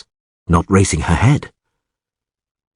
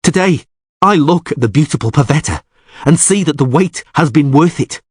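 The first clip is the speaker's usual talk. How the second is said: ordinary delivery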